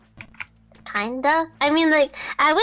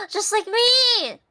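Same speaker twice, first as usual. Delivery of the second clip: delighted; 10 semitones higher, 3 dB louder, slower than usual. Real, not acted. Friend delighted at shared answer.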